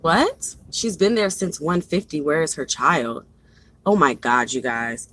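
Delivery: real disbelief, dropping low and soft; low, quiet, exasperated concern; dismayed aside. Real, not acted. Real alarm over her child's pickup.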